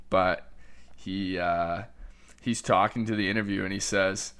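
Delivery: ordinary talk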